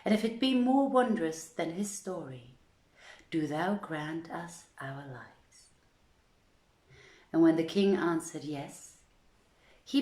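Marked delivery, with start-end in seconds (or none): none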